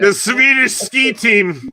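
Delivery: high, loud, drawn-out Swedish caricature; mock Swedish accent. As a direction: announce it slowly in a comic Swedish accent. Comic bit on Scandinavian accents.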